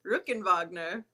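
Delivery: "Ruckenwagner" said ROOK-en-VAHG-ner; correct surname pronunciation. Shown as a demonstration. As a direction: model "Ruckenwagner" with a German V and broad ah. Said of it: "how do you pronounce it".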